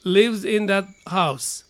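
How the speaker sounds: slow, "Who" stressed; teacher's slow example sentence; slow model reading. Shown as a demonstration. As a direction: read it out slowly and clearly. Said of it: "で、下。".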